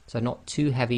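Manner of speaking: ordinary talk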